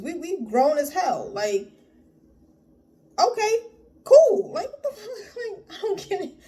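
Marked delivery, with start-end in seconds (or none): 3.2-4.5 mock breezy okay. Mock casual reply to rejection. 4.5-6.3 high, laughing through the words; laughing in disbelief. Real, not acted. Scolding scared men and laughing in disbelief.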